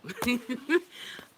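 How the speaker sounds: loud, high laugh; real rising laugh. Real, not acted. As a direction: burst out laughing after a rant. Laughing after her rant.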